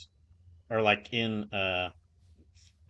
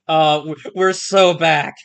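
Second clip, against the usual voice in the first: excited; 4 semitones higher, 10 dB louder, slower than usual. Real, not acted. Celebrating after a restart.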